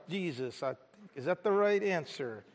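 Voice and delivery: soft, hesitant questioning tone; unsure, like a question. Retold or imitated. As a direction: say it as if unsure it's right. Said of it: "some of you said that like a question".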